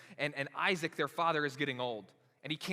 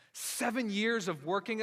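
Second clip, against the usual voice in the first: ordinary delivery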